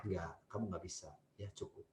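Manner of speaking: soft, low and gentle no; soft low mother saying no firmly; calm firm refusal. Shown as a demonstration. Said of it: "Itu tegas menurut saya".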